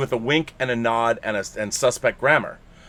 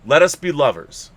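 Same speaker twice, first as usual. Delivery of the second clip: ordinary talk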